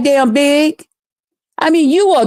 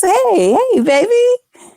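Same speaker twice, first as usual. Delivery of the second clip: slow, rising singsong hello to a viewer; sweet greeting; 8 semitones higher, slower than usual. Real, not acted. Host greeting a viewer in chat, sweet and singsong. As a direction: greet a friend joining the stream, sweetly. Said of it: "greeting viewer Arts and Crafts".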